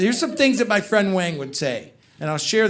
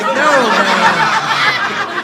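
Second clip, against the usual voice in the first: loud laughing no; hearing about the fish, loud; disbelief; 5 semitones higher, 7 dB louder than usual. Retold or imitated. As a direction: shout in disbelief. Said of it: "I'm like".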